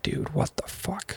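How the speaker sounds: slow, soft, breathy-toned aside of disbelief; imitating girlfriend's quiet stunned disbelief; hushed disbelief. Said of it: "even she was like".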